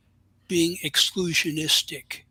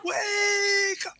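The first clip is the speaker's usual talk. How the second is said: hammed-up shouting call to wake up; loud soapbox ranting; 10 dB louder than usual. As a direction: yell it like a street preacher. Mock rallying the sleeping audience.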